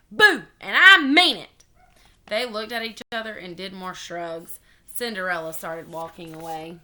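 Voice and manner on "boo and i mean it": loud, high, insistent; shouted. A narrator's reading. Child clown insisting on being scary, shouting. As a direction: shout it like a serious threat. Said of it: "I shouted".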